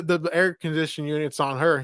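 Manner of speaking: normal talking voice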